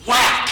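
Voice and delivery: high mock whack. Telling how the boy swatted the bug, high mock whack. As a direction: land the swat with a high playful whack.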